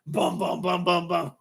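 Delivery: high, rhythmic mouth gunshots; imitated gunfire. Outback man shooting his rifle, imitated gunfire. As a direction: imitate a burst of rifle fire.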